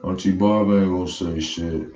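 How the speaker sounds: lightly voiced nephew, low and quiet; imitating the nephew. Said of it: "That's exactly what he said".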